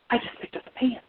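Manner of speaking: hushed, unvoiced whisper of dawning realization. Storyteller realizing the pan was lifted, whispering. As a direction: whisper it in stunned discovery.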